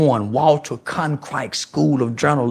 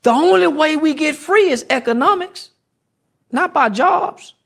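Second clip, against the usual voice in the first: high, slowed preaching; high fired-up sermon pitch; impassioned; 10 semitones higher than usual. Real, not acted. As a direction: raise it up and lean in. Impassioned rant.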